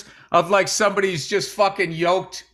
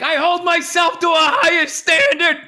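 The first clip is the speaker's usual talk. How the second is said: high-pitched mock shout of protest; indignant retort; 8 semitones higher, 3 dB louder than usual. Husband answers wife about his weight, indignant mock shout. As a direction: act out an overblown, indignant comeback.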